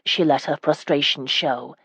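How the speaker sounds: ordinary delivery, nothing marked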